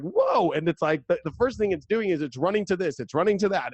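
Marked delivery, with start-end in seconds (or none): 0.0-0.5 high, drawn-out whoa; monkey amazed. Retold or imitated. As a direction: marvel like a monkey out of its cage. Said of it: "it's kinda like".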